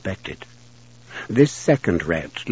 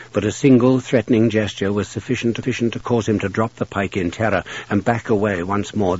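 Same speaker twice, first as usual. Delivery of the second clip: ordinary delivery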